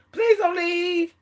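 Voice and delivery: drawn-out, high-pitched mock begging; mock pleading. Woman mimics what narcissists want victims to say, mock pleading. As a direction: plead slowly and high, in mockery.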